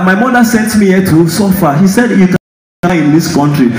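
normal talking voice

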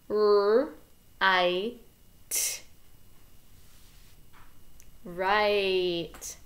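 "R, I, T" separated by pauses, then "Right" blended; sounding out. Shown as a demonstration. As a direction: segment and blend slowly. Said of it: "So it goes like this".

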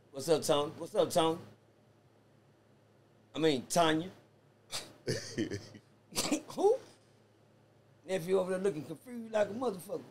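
ordinary delivery

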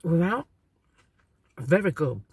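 chewing while speaking; talking between bites; mouth full. Real, not acted. Seen 0: bit hash brown at -5s; lips working, food still in mouth.

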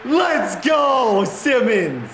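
high, loud call of hype; cheering shout. Real, not acted. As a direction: cheer the player on. Cheering defensive play.

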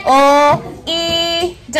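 "O", "E", "D" said as separate drawn-out sounds; blend-practice "O, E, D", one sound at a time; sounding out a word. Shown as a demonstration. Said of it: "Last sound".

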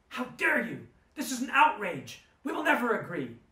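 high, loud indignant shout; outraged yelling. Retold or imitated. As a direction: shout her outrage at him. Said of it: "she started yelling".

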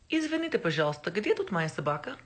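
fast, careful Russian recital; Russian phrase. Shown as a demonstration. Showing off her only Russian phrase to viewers, quick. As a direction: rattle off the one Russian line you know. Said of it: "All that I can say in Russian".

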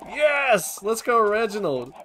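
high, loud cheer; loud, high celebration; excited cheering. Real, not acted. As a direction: cheer a pet on loudly. Cheering the fox on.

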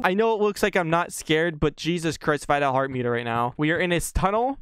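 ordinary talk

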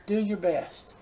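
"Do your best" spoken softly, dropping away; soft motherly advice. Retold or imitated. Preacher recalling his mother's gentle advice, soft. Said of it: "she would always tell me".

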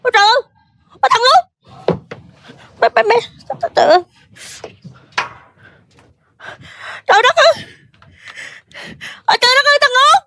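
high-pitched, overwhelmed exclamations; overjoyed. Acted. Mother finding her lost son Út, overjoyed. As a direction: cry out in disbelieving joy at a reunion. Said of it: "má mừng quá trời ơi".

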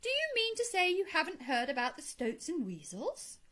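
high, incredulous question; astonished cry. A narrator's reading. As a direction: ask as if he can't believe it. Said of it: "cried the Rat".

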